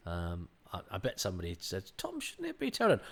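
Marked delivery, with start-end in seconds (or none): 2.0-3.0 mock query. Imagined crew member questioning Tom.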